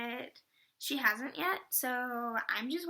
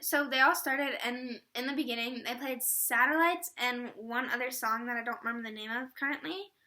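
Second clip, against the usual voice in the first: ordinary delivery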